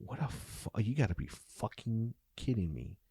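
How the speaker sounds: officer reading the subpoena, muttering in disbelief under his breath; muttered disbelief. Retold or imitated. Soft muttered disbelief. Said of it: "And I go".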